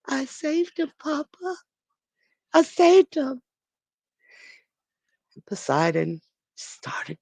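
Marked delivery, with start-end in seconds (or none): 0.1-3.4 put-on much higher, childlike, proud little voice; a small child whispering proudly to his papa; little boy's voice. A narrator's reading. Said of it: "a little voice in his ear".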